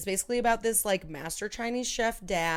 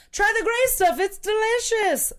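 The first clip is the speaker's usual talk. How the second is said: much higher and louder, hammed-up singsong; cartoon character voice; 10 dB louder than usual. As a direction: belt it like the cheery cartoon servant pushing food. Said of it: "when they're like".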